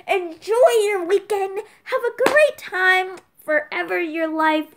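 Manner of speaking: high, loud, drawn-out well-wishing; theatrical slow farewell cheer; gushing send-off. Acted. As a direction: stretch it out, big and cheery. Exaggerated sign-off wishes to viewers.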